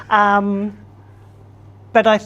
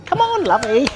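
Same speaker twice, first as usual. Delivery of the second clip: put-on higher-pitched, playful old-lady coaxing; purple-haired old dear; 6 semitones higher than usual. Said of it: "with the purple hair and the".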